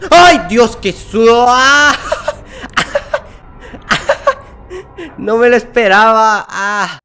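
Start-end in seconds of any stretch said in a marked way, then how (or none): 0.1-1.9 exclamación agudísima y fuerte; grito de susto. Real, not acted. Susto repentino en el juego. 2.0-5.1 carcajada aguda y aireada de alivio; reír del propio susto; risa nerviosa sin voz. Real, not acted.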